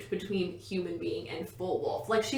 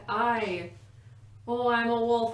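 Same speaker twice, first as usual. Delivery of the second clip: half-hearted, loud stab at a Scottish accent; a rough hint of Scottish, louder; bad Scottish accent; 3 semitones higher, 5 dB louder, slower than usual. Shown as a demonstration. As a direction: try a Scottish accent and don't quite get it. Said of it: "I can't do Scottish accent".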